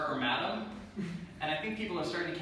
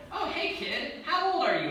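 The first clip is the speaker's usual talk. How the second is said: put-on higher, chirpy talking-to-a-kid voice; cheery adult to child; 9 semitones higher than usual. Daycare worker greeting a kid, chirpy and higher. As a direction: sound like a friendly grown-up. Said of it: "here's how it could go".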